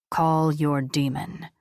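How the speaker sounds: slow deep command from the angel; low commanding angel. Acted.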